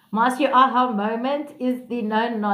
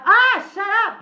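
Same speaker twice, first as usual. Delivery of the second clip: exasperated retort; 9 semitones higher, 5 dB louder than usual. Retold or imitated. Retold quarrel with relatives.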